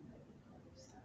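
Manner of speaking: whispered. Acted. Ophelia replies softly.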